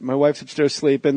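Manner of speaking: ordinary talk, nothing marked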